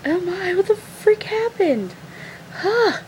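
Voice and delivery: high, incredulous demand to know; startled question. Retold or imitated. Friend reacting to the scream, high startled question. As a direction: react alarmed to a sudden scream.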